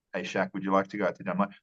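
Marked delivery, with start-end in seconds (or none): none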